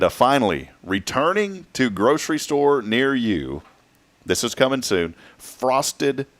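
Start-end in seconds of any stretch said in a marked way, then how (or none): 5.4-6.3 slow careful reading. Shown as a demonstration. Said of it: "Say that twenty times fast".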